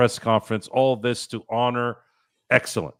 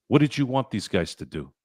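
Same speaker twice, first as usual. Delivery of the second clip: ordinary talk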